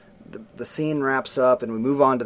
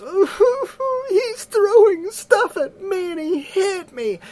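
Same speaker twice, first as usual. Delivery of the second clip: much higher, slow fake whimpering; fake crying girl; 3 dB louder, slower than usual. Sister tattling to mom, high fake crying. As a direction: whine like a tattling little sister. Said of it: "the girl immediately goes".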